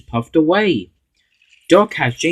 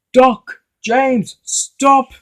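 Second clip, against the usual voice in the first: much higher, loud warning yell; shouting stop; 13 semitones higher, 6 dB louder, much slower than usual. Acted. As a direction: call out to stop them in alarm.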